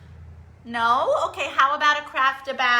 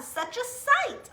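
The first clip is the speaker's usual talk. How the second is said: lilting; 9 semitones higher, 4 dB quieter, slower than usual. Shown as a demonstration. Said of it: "Shall I remind you?".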